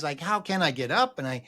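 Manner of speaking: ordinary delivery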